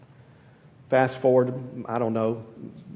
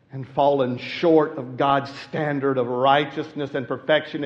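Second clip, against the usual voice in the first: ordinary talk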